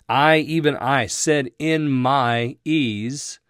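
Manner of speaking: slow "I, even I said, in my ease," with "I" stressed and rising; deliberate emphatic reading. Shown as a demonstration. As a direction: lean on "I" and stretch "in my ease". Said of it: "I have this as an emphatic".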